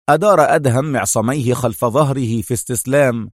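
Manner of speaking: ordinary delivery, nothing marked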